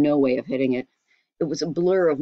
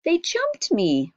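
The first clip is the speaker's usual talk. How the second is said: put-on higher, slow, indignant boy; boy protesting; 13 semitones higher, much slower than usual. A narrator's reading. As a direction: plead his innocence to mother. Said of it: "Walter protested".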